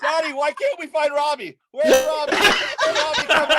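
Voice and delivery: put-on high, loud child calling for a lost brother; mock child voice calling out; kids' voices. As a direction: play the worried kid shouting for Robbie. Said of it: "They were like".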